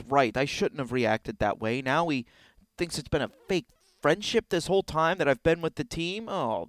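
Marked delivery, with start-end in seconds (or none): none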